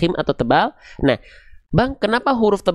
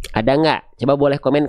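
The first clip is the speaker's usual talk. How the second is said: usual speaking voice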